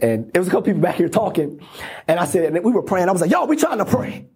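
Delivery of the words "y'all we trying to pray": hollering. Retold or imitated. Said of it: "but you hollering at people".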